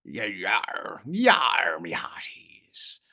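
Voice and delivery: gruff pirate growl. Pretend. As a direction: ham up a pirate. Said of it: "Like a pirate".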